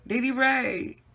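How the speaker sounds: calling a name out loud, high and carrying; hollered name. Retold or imitated. Calling out to Dee Dee Ray across the yard, loud holler. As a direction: holler across the yard to get his attention. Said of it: "And I hollered out".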